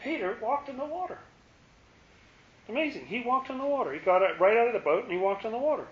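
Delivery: high-pitched, hushed marvelling; amazed wonder. Real, not acted. Marvelling at Peter's miracle.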